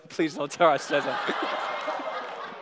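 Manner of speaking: slow, laughing through the words; chuckling plea; laughing aside. Real, not acted. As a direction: let the laugh carry a guilty joke. Joking aside about his wife.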